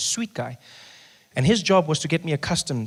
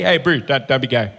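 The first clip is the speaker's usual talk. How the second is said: lower, laughing casual bro warning; 3 semitones lower than usual. Retold or imitated. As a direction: tell a buddy like a pal. Buddy version of the warning.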